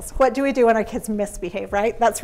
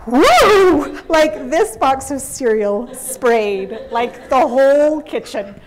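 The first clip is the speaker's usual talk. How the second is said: excited exclamation; 3 semitones higher, 7 dB louder, much slower than usual. Retold or imitated. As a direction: paint the explosion loudly. Cereal box explodes everywhere.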